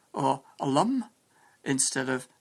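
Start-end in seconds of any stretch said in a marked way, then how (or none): 0.6-1.1 higher-pitched Scots dialect; Scottish accent. Shown as a demonstration. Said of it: "Many Scotsmen say".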